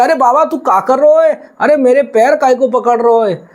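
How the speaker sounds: put-on high, alarmed boy crying out to the old man; a village boy yelping as his feet are grabbed; startled cowherd boy. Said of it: "वो ग्वाल चिल्लावे".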